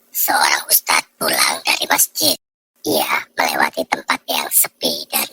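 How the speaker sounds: hushed ghost-story whisper; spooky whisper. A narrator's reading. Narrator opening a ghost story in a hushed whisper. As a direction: whisper it like a campfire tale.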